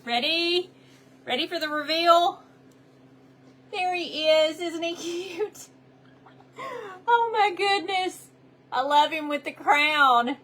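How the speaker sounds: high, gushing reveal; gushing makeover reveal. Real, not acted. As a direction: build up, then gush over it. Crafter unveils finished Jesus ornament.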